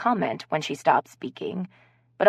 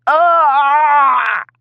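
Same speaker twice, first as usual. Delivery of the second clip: high loud shriek into laughter; much louder shrieked laugh; shrieking laugh burst; 10 semitones higher, 11 dB louder than usual. Acted. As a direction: explode into a howl of laughter. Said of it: "burst into hysterical laughter".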